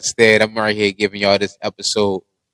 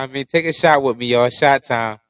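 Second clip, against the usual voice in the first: normal talking voice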